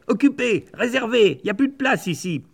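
high, bossy bird calling out; bird shooing intruders. Acted. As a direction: shoo them off like a cranky bird. Territorial birds chase them off.

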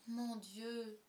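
drawn-out, mildly groaned dismay; moaning. A narrator's reading. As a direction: moan it like a mourner. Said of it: "gémit une voix".

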